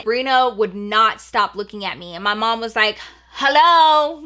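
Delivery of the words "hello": loud, higher-pitched, drawn-out hello; impatient mom. Retold or imitated. Mom to the staring girl at the door, impatient call. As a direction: imitate a mom loudly demanding attention.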